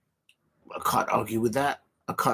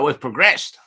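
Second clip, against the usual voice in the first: a hammed-up Australian accent, a bit louder; mock Australian accent; 2 semitones higher, 6 dB louder than usual. Quoting the Australian manager to fans in a mock Australian accent. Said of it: "in Australian accent".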